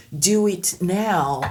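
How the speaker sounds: slow English Do it now with crisp D; English demo. Shown as a demonstration. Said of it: "C'est un peu comme si tu disais".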